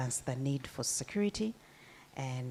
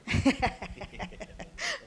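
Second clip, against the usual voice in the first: a bright, high burst of laughter at the joke; amused laugh; 11 semitones higher, 4 dB louder than usual. Real, not acted. Panel host laughing at the endangered species joke. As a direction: laugh at your own joke.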